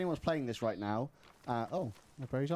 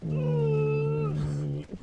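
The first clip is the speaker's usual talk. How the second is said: close-mic voice; 9 semitones lower, 6 dB louder than usual. Shown as a demonstration. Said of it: "weirdest ASMR".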